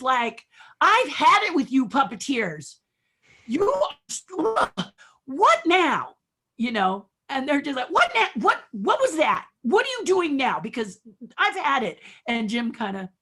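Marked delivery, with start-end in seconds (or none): 0.8-6.2 retold furious producer, loud and high; exasperated acted yelling; so mad. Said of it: "he is so mad". 7.9-12.0 loud, high mock scolding; acted outburst of angry disbelief; exasperated. Producer yelling at puppeteers, retold.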